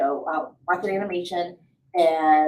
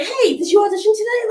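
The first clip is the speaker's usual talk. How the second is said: playful high-pitched chatter; high mock buddy voice; 13 semitones higher, 8 dB louder than usual. Cheering on an audition buddy, high playful voice. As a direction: voice a nervous friend, high and light.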